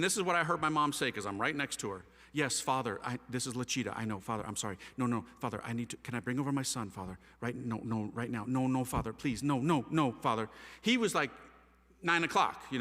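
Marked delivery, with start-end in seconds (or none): 2.3-10.5 low, hurried phone-call pleading; apologetic pleading. Retold or imitated. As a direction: imitate Mom begging a priest on the phone. Mother pleads with priest by phone.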